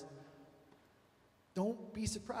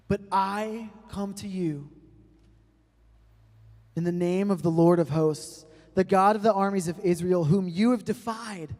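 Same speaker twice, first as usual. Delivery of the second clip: loud, rising battle proclamation; defiant David; 5 semitones higher, 14 dB louder than usual. Acted. David defying Goliath before the armies, a loud proclamation. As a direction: declare it to the giant like a war cry. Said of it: "David says".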